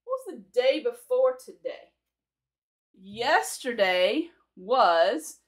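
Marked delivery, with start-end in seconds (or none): 3.0-5.4 very slow, every word stretched; slow teaching voice. Shown as a demonstration. Teacher drawing out the past tense for English learners. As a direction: spell it out slowly for the learners. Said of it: "because it's past tense".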